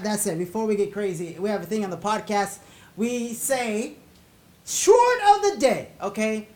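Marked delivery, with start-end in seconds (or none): 4.7-5.9 segment announcement. Acted.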